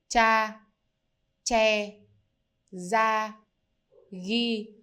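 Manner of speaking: each word said alone, clear and slow; drilling example words. Shown as a demonstration. Teacher drills the example words for learners, slow and clear. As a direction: model each word for repeating. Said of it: "As in the words".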